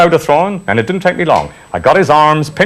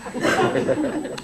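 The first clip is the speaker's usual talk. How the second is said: breathy laugh; 9 semitones higher, 9 dB quieter than usual. Real, not acted. Laugh after mother's reply.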